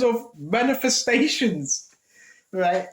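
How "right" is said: usual speaking voice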